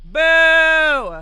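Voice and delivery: long, high, loud cry; sudden shout. Real, not acted. Player calling out mid-game, high drawn-out shout. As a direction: let out a drawn-out loud boo.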